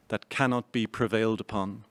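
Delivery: usual speaking voice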